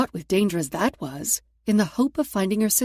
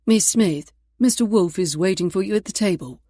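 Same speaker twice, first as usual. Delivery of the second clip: polite maitre d'; 5 dB louder than usual. Acted.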